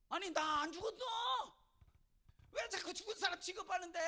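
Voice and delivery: high, loud mock outcry; indignant protest. Person soon to rise objecting to a gravestone, loudly indignant. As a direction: shout back in hammed-up exasperation.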